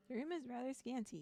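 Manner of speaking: ordinary delivery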